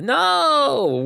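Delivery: put-on high, loud toddler "No!"; toddler refusing. Dad imitating son turning down being held, high shrill no. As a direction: snap it like a cranky little kid.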